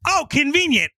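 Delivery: high, chirpy mock delight; squeaky sly surprise; mock-pleased comeback. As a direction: pitch it way up, as if delighted by luck. Beast's gleeful comeback before tagging.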